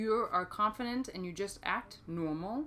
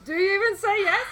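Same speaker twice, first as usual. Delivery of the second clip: teasing; 11 semitones higher, 13 dB louder than usual. Retold or imitated. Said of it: "so I'm like".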